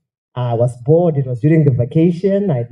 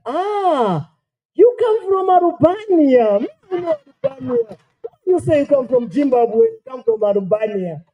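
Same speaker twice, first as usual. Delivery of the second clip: mock Japanese child; 12 semitones higher than usual. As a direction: imitate a Japanese kid suddenly getting it. Child's sudden mistaken realization.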